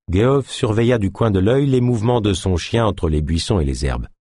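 plain reading voice

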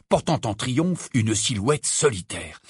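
plain reading voice

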